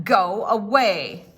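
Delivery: loud, slow gruff command; gruff go away. Acted. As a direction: shoo the dog away slowly. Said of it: "said the man".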